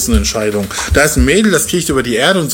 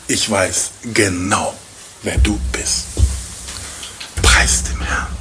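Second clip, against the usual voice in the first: hushed Boas; 6 semitones lower, 5 dB quieter, slower than usual. Acted. Boas speaking tenderly to Ruth.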